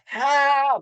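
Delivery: full-voiced desperate yell; loud cry for help. Survivor yelling from the water for rescue, desperate shout. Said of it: "just as loud as I could".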